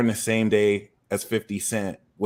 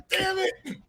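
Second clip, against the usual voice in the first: very high squeaky damn it; mock dismay. Rejected by the driver, squeaky mock dismay. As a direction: squeak out the letdown. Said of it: "I was like".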